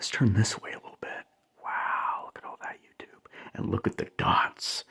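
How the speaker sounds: fading awed whisper; hushed whisper. Real, not acted. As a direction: breathe the words out in wonder. Whispering at the disco dots.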